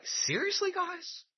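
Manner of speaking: an eye-rolling "really?" to the crowd; exasperated high squeak of disbelief; incredulous. Real, not acted. Preacher mocking the conspirators.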